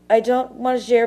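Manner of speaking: ordinary talk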